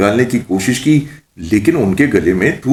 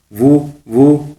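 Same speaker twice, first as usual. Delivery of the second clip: slow, higher, halting stammer; nervous stammer; 5 semitones higher, much slower than usual. A narrator's reading. Mullah answers the witch who asked why he trembles, nervous stammer. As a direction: gulp and grope for words. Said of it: "ملا جی تھوک نکلتے ہوئے بولے".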